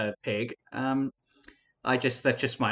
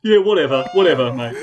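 loud Australian "whatever, mate"; Aussie voice; 9 dB louder than usual. Pretend. Dismissing the moderator in a loud Australian accent. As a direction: brush it off like a loud Aussie bloke.

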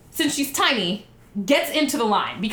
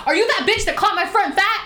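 high-pitched mock accusation; confrontational; 9 semitones higher, 4 dB louder than usual. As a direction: imitate a friend accosting a stranger. Friend confronts strangers, retold.

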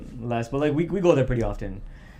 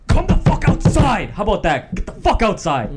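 retold scream; 7 semitones higher, 8 dB louder than usual. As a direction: bellow at them to come outside. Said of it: "And he was screaming".

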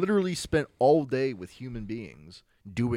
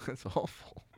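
hushed; 2 semitones higher, 6 dB quieter, slower than usual. Real, not acted. Continued hushed.

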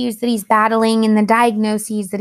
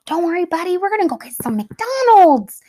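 put-on higher, bright coaxing promise to a toddler; cheery mom voice; 7 semitones higher than usual. Mom promising her fussy son a treat, cheerful and higher. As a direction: sell the treat to a cranky kid.

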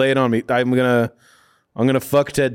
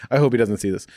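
ordinary talk

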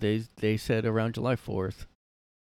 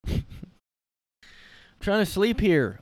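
mock sleepy Biden; 7 semitones higher, 4 dB louder than usual.

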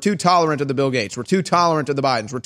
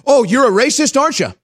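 louder and higher, a mocking gotcha; high, loud mock accusing voice; mock accusation; 8 semitones higher, 4 dB louder than usual. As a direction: sneer the accusation like a smug scold.

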